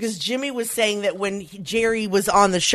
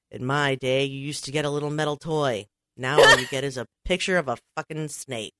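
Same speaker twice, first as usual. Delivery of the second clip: put-on low, gruff, grumbling old-timer complaint; a lowered mock of an aging star, griping; Jack Nicholson impression; 6 semitones lower, 4 dB quieter than usual. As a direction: grumble it low, building to a curse. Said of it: "I wish I could do a Jack impression".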